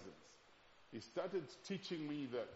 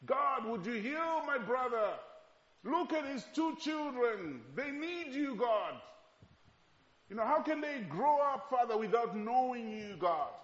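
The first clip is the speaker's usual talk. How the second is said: pleading prayer; 9 semitones higher, 5 dB louder than usual. Retold or imitated. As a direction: beg God to heal his brother. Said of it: "I pleaded with him".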